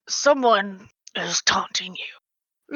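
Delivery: slow, hushed menacing aside; sly whisper. Acted. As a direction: whisper it like a stalking beast. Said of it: "the Manticore's like".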